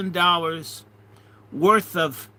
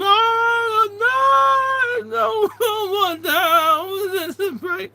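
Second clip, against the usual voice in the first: high, slow panicked wail; retold scream; 4 dB louder, much slower than usual. Thief begging as men attack him, high panicked wail. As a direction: cry out in terror, much higher. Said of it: "this guy screamed".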